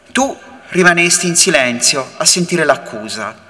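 ordinary talk

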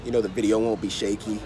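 usual speaking voice

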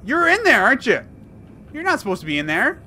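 normal talking voice

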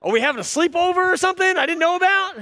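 high-pitched incredulous challenge; acted-out tactless husband's complaint; not so kindly. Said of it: "not so kindly, say".

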